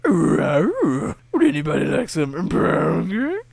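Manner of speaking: comic figure wailing the viewer's comment aloud; loud wailing goofy voice. Acted. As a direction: wail it out like a blubbering oddball. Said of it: "that's actually a comment".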